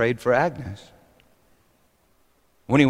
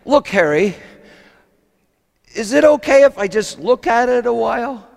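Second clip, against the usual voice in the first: high, mildly tearful plea; weepy; 10 semitones higher, 4 dB louder than usual. Acted. Agnes begs Harry to spare her cake, mildly weepy. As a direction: ask haltingly, as if moved to tears. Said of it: "she broke down weepy".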